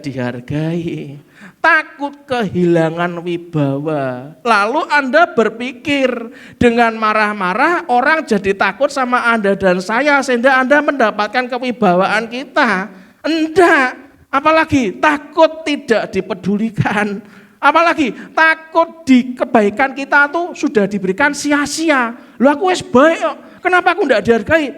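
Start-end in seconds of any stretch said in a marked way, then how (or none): 4.5-22.1 loud, high preaching build-up; fiery preaching. Acted. Preaching at full force. 22.4-24.8 voicing an offended person. Voices the angry person.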